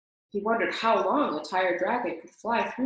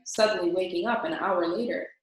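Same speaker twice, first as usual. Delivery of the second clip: usual speaking voice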